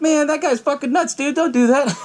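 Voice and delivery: high-pitched mock protest; alarmed warning imitation. Retells friends warning Caleb.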